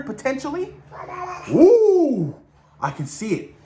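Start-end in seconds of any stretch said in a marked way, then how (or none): 1.5-2.4 high, loud thrilled ooh with a laugh; excited ooh. Real, not acted. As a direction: relish the fan theory. Excited by Dark Avengers theory.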